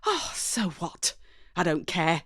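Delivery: plain reading voice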